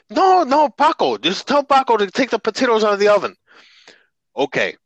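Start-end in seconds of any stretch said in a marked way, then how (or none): 0.1-3.3 put-on high, loud, fast old grandma voice; insistent grandmother. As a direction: insist like an exasperated abuela, quick and high.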